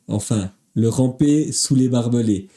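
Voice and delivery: normal talking voice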